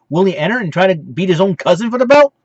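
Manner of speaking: usual speaking voice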